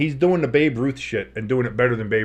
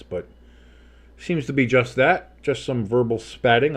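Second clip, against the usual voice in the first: ordinary talk, nothing marked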